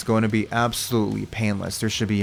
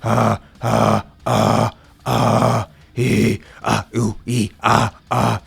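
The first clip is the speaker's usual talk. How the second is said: caveman sounds; 4 dB louder, much slower than usual. Shown as a demonstration. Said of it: "when you make the sound the right way".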